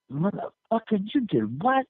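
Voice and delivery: high-pitched mock outrage; outraged disbelief. Tyson's man learns of the toilet paper, outraged. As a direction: imitate a man who can't believe what he just heard.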